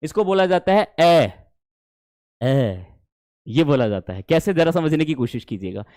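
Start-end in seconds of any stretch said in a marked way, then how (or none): 1.0-2.8 drawn-out long ए as in cat. Shown as a demonstration. Teacher demonstrates the long vowel ए to students. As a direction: stretch the ए sound out long. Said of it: "हमेशा long pronounce किया जाता है".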